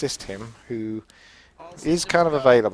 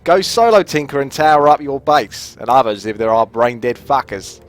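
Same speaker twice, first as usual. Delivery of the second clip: louder, higher read-out; reading viewer message; 5 semitones higher, 6 dB louder than usual. Shown as a demonstration. Streamer reads out a viewer's message, louder and higher. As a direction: read the fan's request aloud with energy. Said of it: "Duckinator from U S East says".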